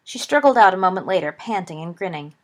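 ordinary delivery, nothing marked

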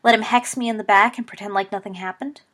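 loud, heated retort; snapping. Acted. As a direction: throw it back as an angry challenge. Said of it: "snapped Sirius".

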